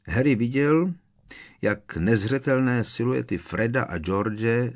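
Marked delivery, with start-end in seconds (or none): none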